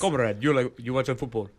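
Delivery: bad Russian accent teasing a co-host. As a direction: play a cartoon Russian football fan. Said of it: "The Russian accent is my favorite, the bad accent".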